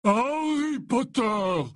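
high, drawn-out toast; drunken bellow. A narrator's reading. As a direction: bellow the name slowly, tipsy. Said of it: "beugla Hagrid".

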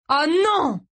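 high, loud cry of dismay; distressed exclamation. A narrator's reading. Boy kicking the sand, distressed exclamation. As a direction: exclaim in panic. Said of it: "s'exclama Gray".

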